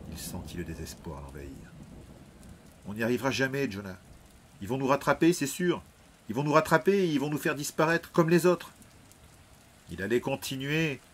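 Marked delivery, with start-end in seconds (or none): 2.9-8.7 panicky, pitch climbing higher; rising fear. Acted. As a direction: voice lifting as the fear takes over. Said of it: "déverser sa peur". (peur qui monte, voix de plus en plus haute)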